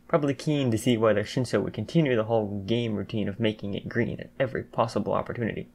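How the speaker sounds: ordinary delivery, nothing marked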